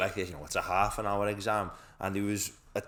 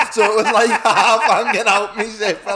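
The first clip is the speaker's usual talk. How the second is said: laughing hard through the words, higher and louder; can barely get it out for laughing; giggling at own cheating story; 12 semitones higher, 15 dB louder than usual. Real, not acted. Laughing while telling cheat story.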